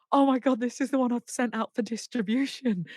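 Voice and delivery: high-pitched, dismayed realisation; retold panic. Singer finding the wrong track went out, retold panic.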